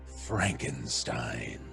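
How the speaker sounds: ordinary talk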